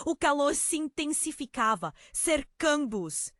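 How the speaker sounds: usual speaking voice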